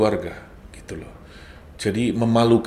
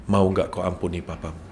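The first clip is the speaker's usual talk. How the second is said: voice of God; 5 semitones lower, 8 dB quieter than usual. Retold or imitated. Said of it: "suara itu berkata".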